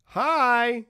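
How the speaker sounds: put-on high, loud little-girl greeting. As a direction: call out in a drawn-out girlish singsong. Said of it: "this little girl standing on".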